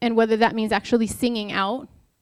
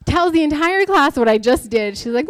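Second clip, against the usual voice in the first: ordinary talk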